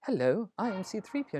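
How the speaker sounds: lightly prim robot butler voice; a hint of the old polite C-3PO. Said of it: "He used to be like".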